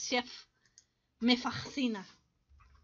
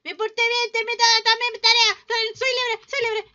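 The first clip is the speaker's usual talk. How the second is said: high, loud, gleeful crowing; jubilant; 6 semitones higher, 8 dB louder than usual. Pretend. Player freed after finishing every task, jubilant cry. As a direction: celebrate at full voice, pitched up. Celebrates finishing all tasks.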